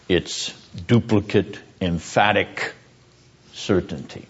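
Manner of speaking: dropping into a solemn, low pulpit hush; grave sermon voice. Acted. Grave low preaching on God's wrath.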